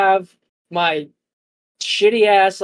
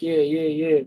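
retold cool reply; 2 semitones lower than usual. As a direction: play along without much energy. The filmed guy's cooler reply.

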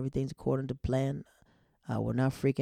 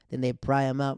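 normal talking voice

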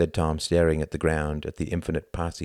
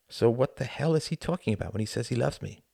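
ordinary talk

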